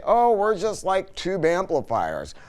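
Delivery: ordinary talk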